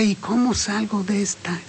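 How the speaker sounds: en susurro, como pensando para sí; susúrralo como duda íntima; susurro preocupado. Pretend. Pregunta interior del oyente. (el oyente se pregunta a sí mismo, en susurro)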